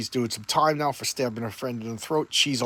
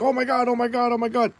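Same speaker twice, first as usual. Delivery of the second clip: high-pitched, repeated imitated fretting; mock panic; 9 semitones higher than usual. Prue sees her friend stabbed, high mock panic. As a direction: babble it high in put-on panic. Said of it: "and now Prue panics".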